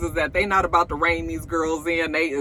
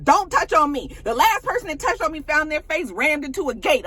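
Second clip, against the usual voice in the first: mock angry warning; 9 semitones higher than usual. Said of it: "So Drew was just like".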